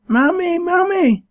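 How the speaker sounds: put-on very high, loud child's shriek. A narrator's reading. Little boy shrieking for his mother, loud and high. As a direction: scream for mammy like a toddler. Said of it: "shrieked little Rob".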